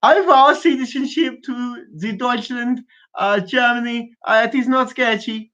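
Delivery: high, slow put-on voice; hammed-up pledge, pitched well up; mock oath-taker. Podcaster mocking a citizenship vow to Germany, in a put-on voice.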